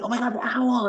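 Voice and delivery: high mock freak-out. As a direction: imitate a panicky girl, pitched very high. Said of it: "freaking out".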